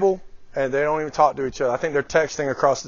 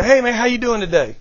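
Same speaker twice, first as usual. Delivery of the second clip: breezy passing greeting; 6 semitones higher, 3 dB louder than usual. Retold or imitated.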